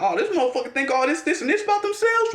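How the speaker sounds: high, fast mocking scoff at a rival. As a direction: sneer at someone who thinks too much of themselves, pitched high. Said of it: "look at a motherfucker and be like".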